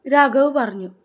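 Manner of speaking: usual speaking voice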